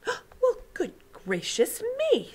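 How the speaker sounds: put-on high, slow, astonished child voice; girl stunned by the giant's hearing; amazed little girl. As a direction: gasp it out in wonder. Sophie amazed.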